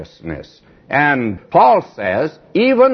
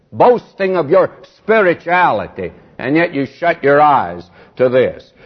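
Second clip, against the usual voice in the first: usual speaking voice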